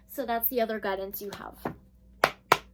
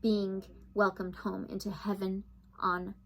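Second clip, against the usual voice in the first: calm, even channeling voice, lower than her chat; soft guided meditation read; 3 semitones lower, 3 dB quieter than usual. Acted. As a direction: read it like a soothing spiritual meditation.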